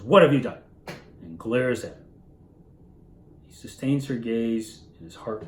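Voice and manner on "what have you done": loud, sharp accusation; accusing mother. Retold or imitated. Wife taking the child from her husband, loud accusing demand. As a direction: burst out at him, demanding an answer. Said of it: "she takes the child from him and says".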